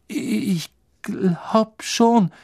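put-on high, slow, stammered frightened boy; trembling. A narrator's reading. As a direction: answer haltingly, quaking with nerves. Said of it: "sagte Neville zitternd". (zitternd und ängstlich stammelnd)

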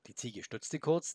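usual speaking voice

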